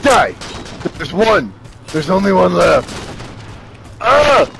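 loud, high bursts of shouting; frantic battle yelling. Real, not acted. Player fighting two enemies in game, frantic yelling. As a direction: yell out each enemy in the heat of combat.

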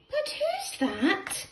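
high, slow who's that; high slow suspense question. A narrator's reading. Narrator asking the children, high and slow. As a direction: stretch it out high and curious.

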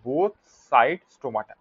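"both side stomata" slowly, word by word; slow emphatic repeat. Shown as a demonstration. Teacher repeating a key point to students, "both side stomata" slow and spaced.

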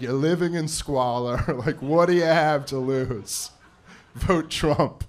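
lightly mocked blunt campaign pitch; Donald Trump.

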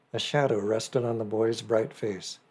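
ordinary delivery

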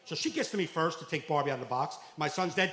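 ordinary delivery

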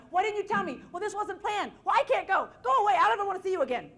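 high, loud, furious outburst; hammed-up tantrum, yelling him away; throwing a fit. Wife throwing a fit at her husband, shrill loud yelling. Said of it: "threw a fit and acted like".

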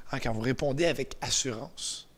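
ordinary talk, nothing marked

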